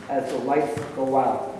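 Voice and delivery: ordinary talk, nothing marked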